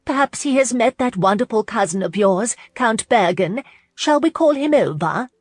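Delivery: high, loud hostess chirp. A narrator's reading. Hostess suggests fetching the Countess's cousin, chirping. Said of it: "her hostess chirped".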